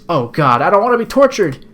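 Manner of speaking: loud, high mock dread; theatrical frightened outburst; hammed-up panic. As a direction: blurt it out in comic terror. Heroine fears torture.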